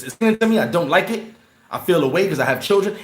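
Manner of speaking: usual speaking voice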